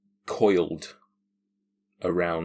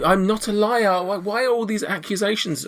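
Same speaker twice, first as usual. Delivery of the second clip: high, loud, flustered protest; hammed-up wounded denial; protesting innocence; 13 semitones higher, 3 dB louder than usual. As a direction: plead innocence in a much higher voice. Accused woman protests in character.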